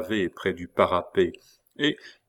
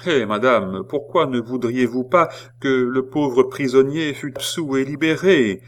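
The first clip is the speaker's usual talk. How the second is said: concierges objecting; 5 semitones higher, 5 dB louder than usual. Acted. Concierges reply together.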